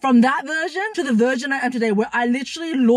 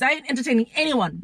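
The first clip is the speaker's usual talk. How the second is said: ordinary talk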